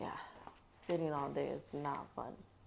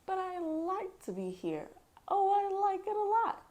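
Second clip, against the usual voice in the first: much higher, louder squeaky cat; gleeful cat; 13 semitones higher, 6 dB louder than usual. Acted. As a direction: squeal with delight.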